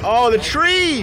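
loud, high-pitched exclamation; alarmed shout. Real, not acted. Viewer watching a car head for the tree, alarmed shout. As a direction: cry out as the crash comes.

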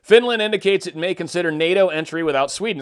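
usual speaking voice

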